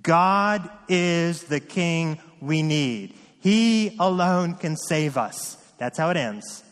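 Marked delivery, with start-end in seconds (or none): 0.0-5.6 slow, weighty declaration with every word set apart; exclamation point delivery. Shown as a demonstration. Preacher proclaiming the chapter's ending to the church, slow and emphatic. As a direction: land it like an exclamation point, slowly and firmly. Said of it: "ends with an exclamation point".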